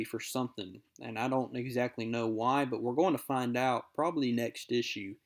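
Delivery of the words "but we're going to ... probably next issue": normal talking voice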